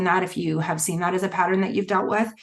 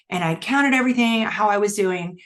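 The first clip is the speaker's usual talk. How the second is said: ordinary talk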